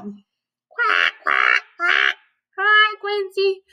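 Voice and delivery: quacking like a noisy duck, then a high squawky hello; loud duck quacks. Acted. Reading to kids, loud duck Dottie quacking. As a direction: ham up the duck. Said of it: "shouts Dottie".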